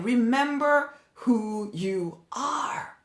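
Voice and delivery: quiet heartfelt reminder; soft slow reminder. Real, not acted. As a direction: say it tenderly and slowly.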